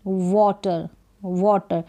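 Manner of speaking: वाटर with a rounded aw vowel, "wɔːtar", said slowly; slow teaching demo. Shown as a demonstration. Teacher models वाटर for Hindi learners, rounded o sound. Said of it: "ए है इसको ओ करके pronounce करेंगे".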